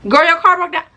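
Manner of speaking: high told-you-so; mock gloating. Gloating to the friend whose car broke down, high told-you-so. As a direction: rub it in.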